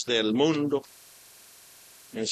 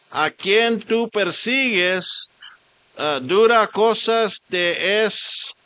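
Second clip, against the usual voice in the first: preaching at full force; 5 semitones higher, 7 dB louder than usual. Acted. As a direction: call out like a preacher on fire. Preaching Saul's conversion.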